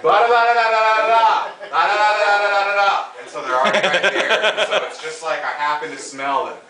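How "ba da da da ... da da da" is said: loud, higher mock chatter of da da da; hammed-up loud club talk; loud nonsense babble. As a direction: babble loudly as if over club noise. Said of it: "I pull them in and I'm like".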